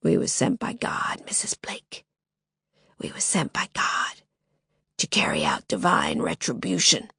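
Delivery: masked man whispering into Lily's ear, foggy. Acted. Foggy menacing whisper. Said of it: "voice foggy and indistinct".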